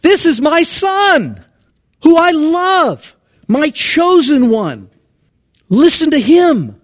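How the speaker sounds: high, slow, booming and loud divine pronouncement; God's voice with inflection. Shown as a demonstration. Preacher voicing God from the cloud, high slow booming proclamation. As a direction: proclaim each phrase like a voice from heaven. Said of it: "I wanna do inflections".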